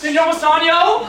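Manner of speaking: loud, high hail rising at the end; calling out. Acted. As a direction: hail a friend across the street. Calls out to a friend.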